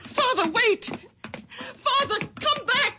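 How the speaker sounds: high, desperate calling out; calling after him. Acted. Daughter calling after her father as he storms out, high-pitched and desperate.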